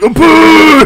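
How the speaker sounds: very high, loud jeer; hammed-up heckler's boo; loud mock booing. Jeering the kid walking back down, loud boo.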